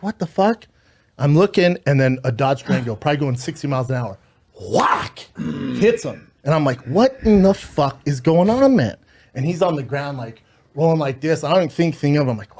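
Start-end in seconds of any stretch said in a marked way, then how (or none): 0.0-0.7 pitched-up disbelieving curse; retold stunned reaction, pitched high; shocked outburst. Shocked at man running across freeway. 6.9-9.0 slow, higher, drawn-out incredulous curse; retold disbelief, raised and slowed; stunned disbelief. Man struck by Durango.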